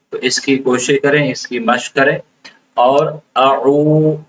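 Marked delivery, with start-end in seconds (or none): 2.7-4.2 "اعو" with the ain from the mid throat, vowel drawn out; stretched ain. Shown as a demonstration. Teacher showing students the stretched ain in "اعو". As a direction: slowly pull out "اعو" from the throat. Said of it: "اس کو کھینچنا ہے".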